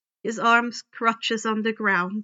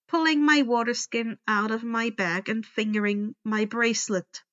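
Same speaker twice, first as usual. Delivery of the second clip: ordinary delivery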